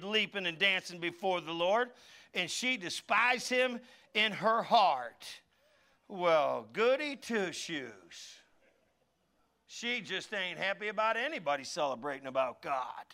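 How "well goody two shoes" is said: sneering scorn. Retold or imitated. Mocking Michal's scorn.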